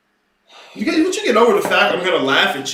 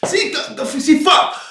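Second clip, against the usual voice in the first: sudden sharp outburst, higher; frustrated curse; 8 semitones higher than usual. Real, not acted. Lecturer frustrated with his audience, blurts a curse.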